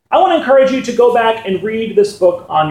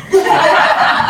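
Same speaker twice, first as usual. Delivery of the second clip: breathy laugh; 9 semitones higher than usual. Real, not acted. Laughs at own joke.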